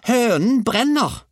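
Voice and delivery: very high, loud, drawn-out yell; grandmother's bellow. A narrator's reading. Grandmother cries fire over the hen, high slow yell. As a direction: stretch the alarm into a screech. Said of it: "vrælte bestemor".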